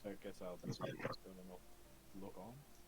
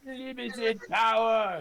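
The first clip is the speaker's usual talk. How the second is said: booming Palpatine cry; Palpatine shout; 13 semitones higher, 16 dB louder, slower than usual. Retold or imitated. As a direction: bellow it like the Emperor.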